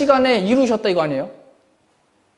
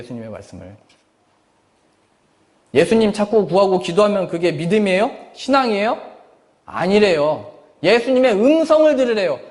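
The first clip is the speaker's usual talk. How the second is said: ordinary talk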